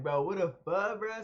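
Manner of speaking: loud, startled bro what the fuck; yelling in disbelief at the door; retold shocked yell. Retelling yelling at the lady at the door, loud and startled.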